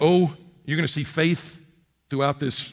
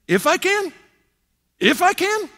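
much higher, incredulous repeat; mocking echo; 13 semitones higher, 3 dB louder than usual. Jesus echoing the father's doubt, high and incredulous. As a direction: toss the words back in disbelief. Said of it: "Jesus says".

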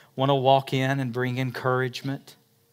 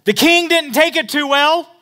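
preaching at full force; 12 dB louder than usual. Acted. Preaching peak.

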